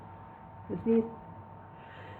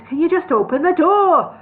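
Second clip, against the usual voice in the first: annoyed; 7 semitones higher, 6 dB louder than usual. A narrator's reading. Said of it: "his beard twitching with annoyance".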